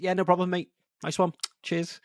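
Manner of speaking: high mock-cheery thanks; cheery thanks, dripping sarcasm; sarcastic. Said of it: "I go for the sarcastic".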